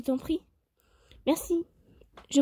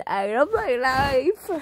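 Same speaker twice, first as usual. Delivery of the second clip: ironic sigh; much slower than usual. Real, not acted. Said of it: "ironic aside in English".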